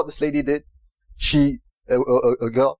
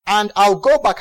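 ordinary talk, nothing marked

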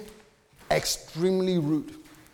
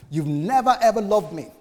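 louder, higher hurt accusation; a wife hurling her grievance, raised voice; upset wife; 6 semitones higher, 5 dB louder than usual. Retold or imitated. Wife accusing the husband of not loving her, raised and high. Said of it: "This is the wife telling the husband".

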